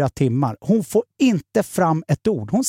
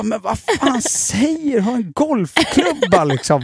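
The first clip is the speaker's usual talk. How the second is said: shocked disbelief; 8 semitones higher than usual. Retold or imitated. Seeing the hidden golf club, high and incredulous.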